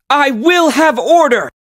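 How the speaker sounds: commanding shout. Acted. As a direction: bellow the order for silence. Shouted take.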